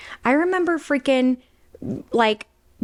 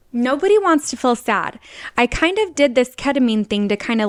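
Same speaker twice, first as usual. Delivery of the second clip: ordinary talk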